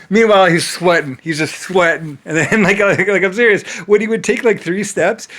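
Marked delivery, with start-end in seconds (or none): none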